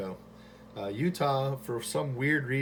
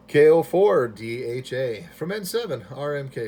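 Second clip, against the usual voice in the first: loud call sign; 3 semitones higher, 11 dB louder than usual. Real, not acted. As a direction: call sign announced loud and firm over the radio.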